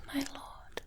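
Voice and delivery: usual speaking voice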